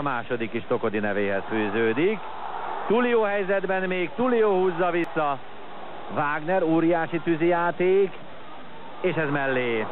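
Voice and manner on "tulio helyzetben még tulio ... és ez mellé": high, rising live call; building attack excitement; excited sports commentary. Real, not acted. Attack in the box.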